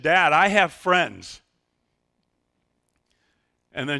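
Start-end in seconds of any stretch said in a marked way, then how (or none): 0.0-1.4 loud, slow pleading; pleading protest. Retold or imitated. Eight-year-old protesting the move, loud slow plea. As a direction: drag it out, loud and hurt. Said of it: "And she said".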